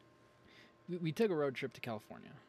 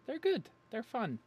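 much higher, louder hype; hyped sales pitch; 11 semitones higher, 6 dB louder, slower than usual. Acted. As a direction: sell it like a TV ad. Hyping his own videos.

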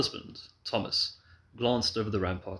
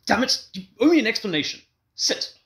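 loud, high commanding voice; lion tamer; 14 semitones higher, 9 dB louder than usual. A narrator's reading. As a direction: bark orders like a lion tamer. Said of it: "he assumed his lion tamer's voice".

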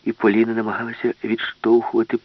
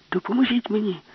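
much higher, pleading call; urgent plea; 13 semitones higher than usual. Acted. Alice pleads with the professor, much higher urgent call. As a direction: pitch it high and urgent.

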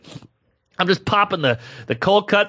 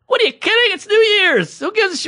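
much higher, exasperated disbelief; incredulous pleading. Acted. Comic begging a working waitress for a kiss, high and incredulous. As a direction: squeak out the outrage.

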